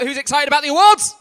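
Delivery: loud, high shout rising to the end; hyping the crowd. Real, not acted. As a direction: whip the audience up with a big shout. Host hyping up the audience.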